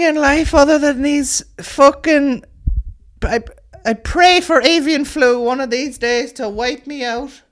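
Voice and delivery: high, slow, heartbroken eccentric lady; pigeon lady voice. Acted. Comic telling the audience, as a high batty pigeon lady. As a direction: pitch it way up and drag it out like a batty bird woman.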